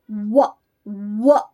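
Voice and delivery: व as English "wuh", lips rounded; rounded w sound. Shown as a demonstration. Teacher showing W differs from V, व as "wuh". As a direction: glide into व as "wuh", teeth off the lip. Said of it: "इसको अलग तरह से बोला जाता है".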